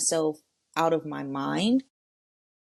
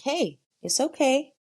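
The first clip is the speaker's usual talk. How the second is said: higher-pitched, soothing pep talk; reassuring a child; 6 semitones higher than usual. Acted. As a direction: reassure your child self in a lifted voice. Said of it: "How do you need to be spoken to".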